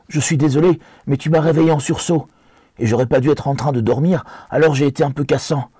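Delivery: hobbit sur la défensive, plus aigu; s'excuser et se justifier, voix haute; Sam penaud. Acted. (Sam un peu coupable, ton plus aigu)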